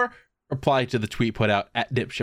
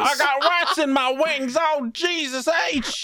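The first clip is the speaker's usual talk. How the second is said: very high, drawn-out Southern twang; Southern coked-up Tim Allen; slower than usual. Retold or imitated. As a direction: ham up a wired Southern guy. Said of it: "this fucking Southern coked up Tim Allen".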